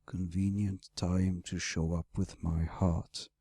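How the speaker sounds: low interrupting dragon, anger held under; growling, simmering anger. Acted. Dragon interrupts his rescuer's explanation, growling with simmering anger. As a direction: cut in low, anger simmering beneath the words. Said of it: "anger was simmering beneath growling words".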